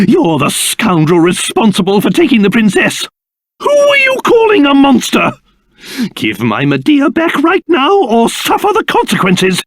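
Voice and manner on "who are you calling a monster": high-pitched, affronted retort; indignant outburst. Acted. Character stung at being called a monster, indignant. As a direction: bristle at the insult.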